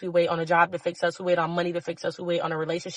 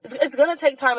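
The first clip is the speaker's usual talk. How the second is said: raised, fervent plea, voice pitched higher; impassioned higher-pitched urging; passionate; 6 semitones higher than usual. Real, not acted. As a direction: pour your whole heart into it, pushing upward. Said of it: "I'm sorry that I'm so passionate".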